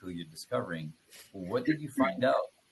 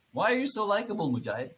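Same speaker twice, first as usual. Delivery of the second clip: high, loud teasing jab; squeaky mock outrage; mock accusation; 11 semitones higher, 6 dB louder, faster than usual. Real, not acted. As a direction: playfully accuse him of being too likable. Teasing host's mock challenge.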